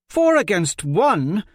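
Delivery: high, loud, slow cry of protest; shouted protest. Acted. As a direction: call out the unfairness, loud and high. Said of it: "Jondralin shouted".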